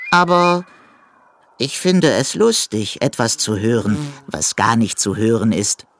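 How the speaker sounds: hohe, verschmitzte Eidechsenstimme; vergnügte Eidechse. A narrator's reading. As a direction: lustig und verspielt erklären. Eidechse erklärt fröhlich. (die Eidechse erklärt dem Hasen den Spaß, verschmitzt)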